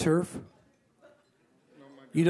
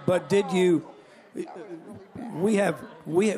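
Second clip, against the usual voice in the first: ordinary delivery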